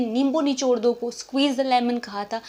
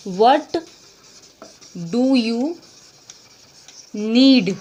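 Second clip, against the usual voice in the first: each word apart and louder; slow loud dictation; 4 dB louder, much slower than usual. Shown as a demonstration. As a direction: model it word by word for students. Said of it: "तो आप क्या बोलोगे इसको?". (हर शब्द अलग, ज़ोर से)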